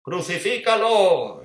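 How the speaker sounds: high, slow drawn-out shout; crowd shout. Acted. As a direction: shout it like the mob. The masses shout.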